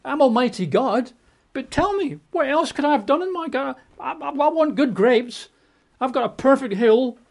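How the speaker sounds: high, pleading divine lament; voicing God. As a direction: plead as a hurt God who did everything. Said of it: "God says".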